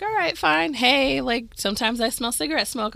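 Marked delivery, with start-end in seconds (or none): 0.0-1.2 higher, sing-song resigned hello; resigned greeting to a ghost. Retold or imitated. Talking to the unseen presence, resigned hello. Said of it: "So I'm just like".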